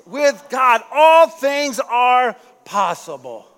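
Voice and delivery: loud, slow proclamation; preaching climax. Real, not acted. Preacher declaring God's promise to the church, loud. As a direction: proclaim it with full force.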